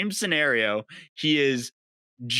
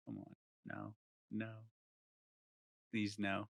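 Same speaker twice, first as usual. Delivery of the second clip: much softer, begging under the breath; quiet pleading; 5 semitones lower, 14 dB quieter than usual. Retold or imitated. Friend recalls begging the girl to stay away, hushed pleading. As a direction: plead softly, no no please. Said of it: "and I was like".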